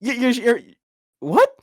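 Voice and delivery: retold shock, voice jumping up; stunned disbelief. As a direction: stammer up to a high, loud "what". Retells being stunned by fake accent.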